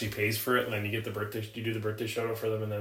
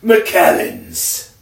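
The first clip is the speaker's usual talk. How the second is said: mock exclaim; 3 semitones higher, 13 dB louder, much slower than usual. Joking shout of whiskey name.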